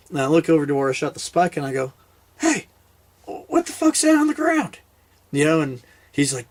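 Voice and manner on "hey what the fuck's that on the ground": very high-pitched mock puzzlement; feigned surprise. Hunter pointing out the spike spot to buddy, feigned surprise. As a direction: act as if you've just noticed something odd.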